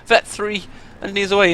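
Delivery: yelling at the player to pull back; excited yell. Real, not acted.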